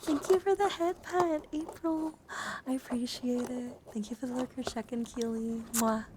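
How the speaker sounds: cutesy thanks. Real, not acted. Streamer thanking a viewer for a head pet, cutesy. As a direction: thank them in a high, sing-song little voice.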